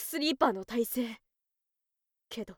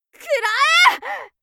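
shrieked, much higher attack yell; battle cry; 13 dB louder than usual. Acted. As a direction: scream it as you launch the move. Launching her finishing move.

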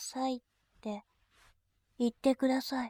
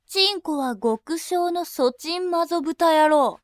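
lower, harsher voice spitting insults, some bursts loud; berating insults; 3 semitones lower, 3 dB louder than usual. Acted. Attendant berating her customer, contemptuous barking insults. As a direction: drop the politeness and tear into him. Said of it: "罵倒しながら".

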